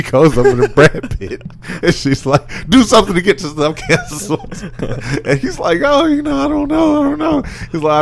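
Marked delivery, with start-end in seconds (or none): 2.7-4.7 higher, laughing through the demand; laughing quote. Retold or imitated. Retelling the woman's demand, laughing. 5.8-7.4 much higher, dodging mock voice; evasive dodge. Voicing Brad Pitt's evasive reply.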